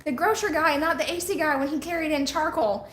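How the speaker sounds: high, disbelieving question; incredulous. Retold or imitated. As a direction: ask it in mock disbelief. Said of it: "I'm like".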